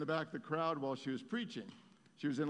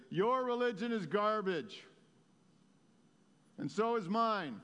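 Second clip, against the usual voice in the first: raised, louder contempt for religion; scornful retort; 5 semitones higher, 4 dB louder than usual. Retold or imitated. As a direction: spit out the scorn, a bit higher. Paralyzed man scorns religion.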